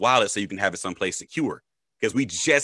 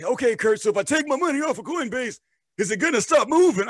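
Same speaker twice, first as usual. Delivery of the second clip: put-on high, wavering old man voice; 13 semitones higher than usual. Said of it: "the worst old man voice ever".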